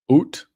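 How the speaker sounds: "Oot" for out, loud and high with an oo; Canadian "Oot". Acted. Streamer joking about how Canadians talk, mock Canadian "Oot".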